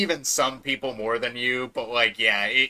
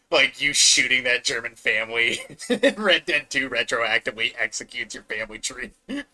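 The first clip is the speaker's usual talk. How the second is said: ordinary talk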